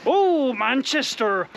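long, high cooing in a put-on accent; Barbara. Cafe owner hearing where lads are from, exaggerated accent. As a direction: stretch it out as an impressed cafe lady, mock regional accent. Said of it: "that's the best accent I can come up with".

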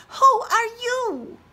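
very high, tiny tadpole voice. Acted. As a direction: squeak it like a baby tadpole. Tadpole asks.

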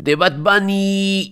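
high, slow drawn-out request; slow high put-on voice. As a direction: stretch it out in a funny voice. Viewer voices requesting themes.

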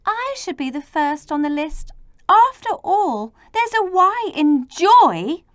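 crying out. A narrator's reading. Y claiming first place on the list, eager cry. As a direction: pipe up excitedly and build. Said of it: "Then Y cried".

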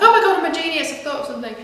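high, loud burst of mock self-amazement, easing off; excited self-congratulation. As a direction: gush as if struck by a brilliant idea. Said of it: "getting excited".

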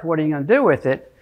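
ordinary talk

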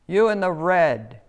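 loud police chief bark; barked order. A narrator's reading. Police chief orders the crab away, loud bark. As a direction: bark it like a cop. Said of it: "he barked at the crab".

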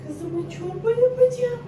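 high singsong promise. Real, not acted. Mother promising her daughter soup, high cooing.

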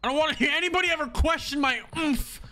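squeaky effect voice taunting chat; pitch-shifted voice. Real, not acted. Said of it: "I can do it with a soundboard".

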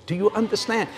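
ordinary delivery

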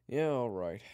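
loud, high drawn-out concession; reluctant acceptance. Acted. Kyler finally accepts Eric's apology, reluctant. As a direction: give in at last. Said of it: "he said finally".

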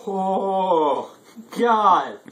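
loud strained oh God; pained groan. Real, not acted. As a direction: groan through the burn. Reacting to burning hot sauce.

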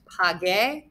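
ordinary delivery

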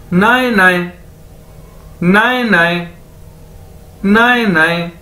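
"नाए, नाए" run together as one word; careful language-teacher "नाए, नाए"; demonstrated pronunciation. Shown as a demonstration. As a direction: drill "नाए, नाए", pausing between takes. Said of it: "इसको दो बार बोला जाए".

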